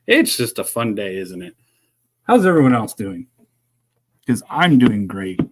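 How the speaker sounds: loud mock-cheerful sarcasm; sarcastic. Real, not acted. Streamer chatting as the microphone fails, sarcastic. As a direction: punch the cheer so it rings false. Sarcasm as everything keeps failing.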